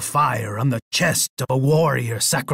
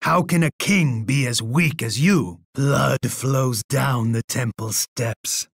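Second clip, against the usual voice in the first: usual speaking voice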